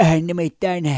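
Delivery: quick catchphrase in a comedian's voice; Spede impression. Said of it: "se on spede".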